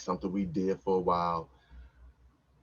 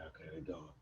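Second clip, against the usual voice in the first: much softer, hushed; hushed all clear; 3 semitones lower, 18 dB quieter than usual. Retold or imitated. Man telling partner the coast is clear, hushed. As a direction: breathe it out quietly. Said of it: "I'm like".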